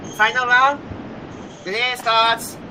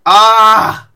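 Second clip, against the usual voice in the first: surprised exclamation; 10 dB louder than usual. Real, not acted. Caught out in counting game.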